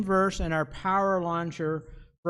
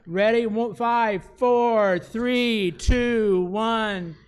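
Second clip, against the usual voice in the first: high, loud hype countdown; excited countdown; 3 semitones higher, 7 dB louder, slower than usual. Real, not acted. Hyping kids for countdown.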